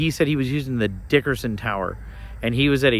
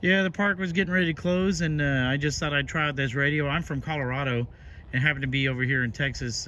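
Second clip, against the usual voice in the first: walkie-talkie; 8 dB quieter than usual. Real, not acted. Radio contact recorded from walkie-talkie.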